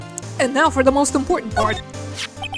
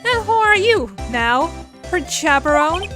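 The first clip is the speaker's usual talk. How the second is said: a touch of Southern twang; Southern woman voice; 6 semitones higher, 5 dB louder than usual. Acted. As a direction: play a sassy country gal. Southern character.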